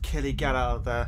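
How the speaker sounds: subdued warning. Real, not acted. Viewer warning Killy of danger, subdued. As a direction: urge him under your breath. Quiet warning to film character.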